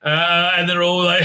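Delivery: usual speaking voice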